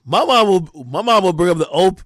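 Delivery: ordinary talk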